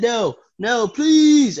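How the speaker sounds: high, loud cry climbing on "please"; acted desperate plea. Mysterio threatened with hell, acted desperate begging. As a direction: beg in mock terror, voice shooting up. Dragged back down, begging in terror.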